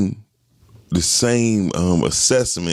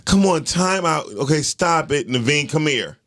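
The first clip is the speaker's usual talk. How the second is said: higher, coaxing mock appeal; mock lenient pleading; 6 semitones higher than usual. What the principal skipped with the daughter, mock pleading. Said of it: "He didn't go through all that".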